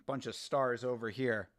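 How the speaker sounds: ordinary talk, nothing marked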